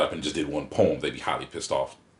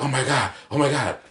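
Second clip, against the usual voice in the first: high, overwhelmed exclamation; awestruck; 8 semitones higher, 4 dB louder than usual. Retold or imitated. Acting out a listener hearing the poet, in high-pitched awe. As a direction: repeat it high, as if blown away.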